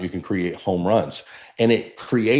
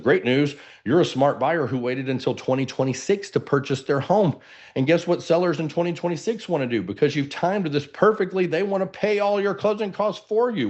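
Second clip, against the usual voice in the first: selling good news; 7 semitones higher than usual. Shown as a demonstration. Agent pitching to buyers, rising excitement. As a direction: voice climbing higher and higher with excitement. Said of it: "demoing peaks of a presentation".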